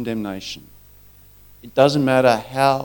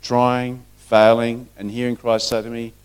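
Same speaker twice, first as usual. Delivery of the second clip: ordinary talk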